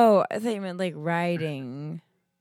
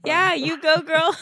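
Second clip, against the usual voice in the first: high, loud sassy cheer; excited; 9 semitones higher, 7 dB louder than usual. Real, not acted. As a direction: hype yourself up. Cheering herself on, laughing.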